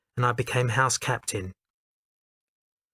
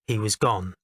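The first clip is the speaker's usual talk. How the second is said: ordinary delivery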